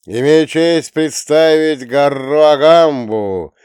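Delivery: loud, slow, high announcer call; arena announcer. Acted. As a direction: present the fighter to a roaring crowd. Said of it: "Голос ведущего стихает".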